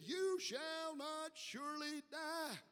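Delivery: high, slow, drawn-out proclamation; declaimed quote. Retold or imitated. Serpent contradicting God to Eve, declaimed slowly.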